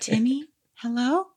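wounded fawn voice. Retold or imitated. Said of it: "wounded fawn impression".

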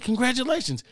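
high-pitched defensive explanation; protesting innocence. Retold or imitated. Pleading his case on phone.

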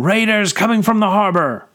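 high, loud warning; shouting alarm. A narrator's reading. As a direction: yell the news to the troops. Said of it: "Kasejian cried again".

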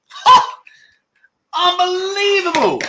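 high-pitched burst of triumph; elated disbelief. Real, not acted. Quiz host after finally getting a winner, elated disbelief. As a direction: exclaim it, thrilled and amazed. Host celebrating the winning answer.

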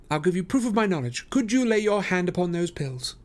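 high, brisk, assured detective; Holmes, confident. A narrator's reading. Holmes to the detectives, high and assured. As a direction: lay out the case with certainty. Holmes voiced higher throughout.

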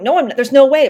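mock freakout, pushing it away; panicked refusal. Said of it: "having lots of freakouts about it".

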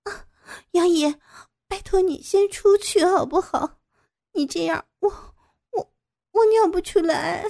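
high, halting, distressed voice; ashamed, anxious pleading. A narrator's reading. Captive begs her captor to leave, flustered pleading. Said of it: "傅菊英羞急地说".

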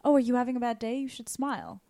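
normal talking voice

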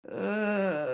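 soft, crying ugh; a hint of a dejected teen groan; mock groan of dismay. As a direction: groan like something sinks in your gut. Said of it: "Max is like".

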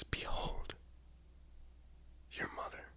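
whispered "Behold, your mother" on a dying breath; pained whisper. A narrator's reading. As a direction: breathe it out like a dying man on the cross. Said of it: "a pained breath".

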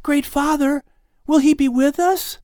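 put-on high, eager child's voice; a boy begging his grandfather; young boy voice. A narrator's reading. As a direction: plead like a small boy. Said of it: "Lamech continued".

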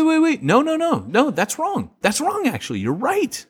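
high-pitched flustered correction; real surprise at being wrong; sudden realization. Real, not acted. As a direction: backtrack in a high, excited voice. Suddenly remembers he was wrong.

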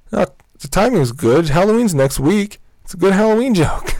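defensive insistence. Retold or imitated. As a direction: mimic a coworker insisting his joke works. Coworker defends his joke, acted out.

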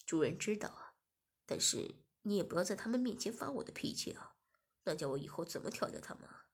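lightly voiced master pleading his excuse; low master voice. Master excusing himself to Shiya, low and coaxing.

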